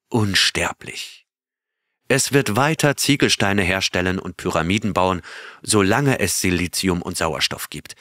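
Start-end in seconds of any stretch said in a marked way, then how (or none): none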